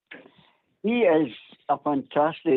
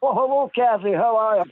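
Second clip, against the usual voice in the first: higher, put-on posh greeting; 6 semitones higher than usual. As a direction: say it like a posh gent. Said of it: "Put my posh voice on for you".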